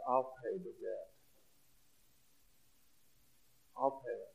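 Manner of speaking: a tender hint of a merciful judge; kind judge. Judge comforting the crying girl, quietly. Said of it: "and he says".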